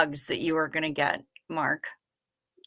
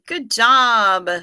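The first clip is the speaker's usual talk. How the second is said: normal talking voice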